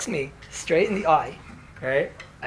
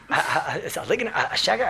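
hesitant stammer; much faster than usual. Retold or imitated. Younger man daring to challenge the rabbi, stammering. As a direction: stammer before getting the word out.

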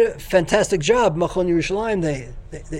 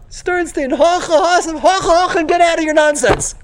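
higher, louder scolding cry, half-joking; mock scolding; 10 semitones higher, 6 dB louder than usual. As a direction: bark the correction like a stern teacher. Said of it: "he was telling me".

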